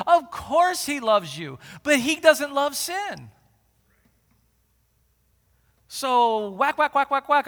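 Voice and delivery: loud, slow emphatic point; loud preaching. Acted. Preacher insists to the congregation, loud and slow. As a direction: hammer the point home.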